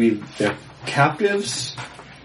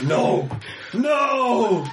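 anguished "No!", the second much higher; scream; 8 semitones higher than usual. Acted. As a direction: howl it out in torment. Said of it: "And then Will screams".

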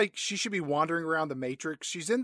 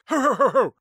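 high, loud put-on ho-ho-ho; hammed-up jolly chortle; mock hearty laugh; 8 semitones higher, 8 dB louder than usual. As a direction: boom out a fake belly laugh, pitched high. Acting out a character's jolly line.

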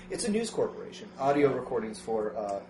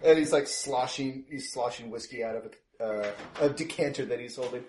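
ordinary talk

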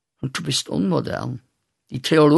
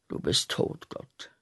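geflüstert; 9 dB quieter than usual. Acted. Sagt Gott tot.

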